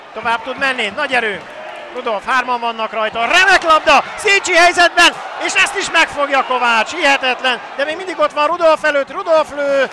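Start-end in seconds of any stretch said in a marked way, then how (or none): 3.2-9.8 excited hungarian sports commentary. Real, not acted. Commentator calls an attack on goal.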